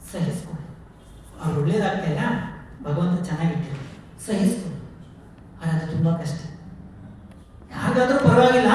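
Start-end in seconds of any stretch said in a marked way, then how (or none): 0.1-5.9 a soft, breathy whisper of the word. Acted. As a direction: hush it like quiet advice. Whispered advice to endure.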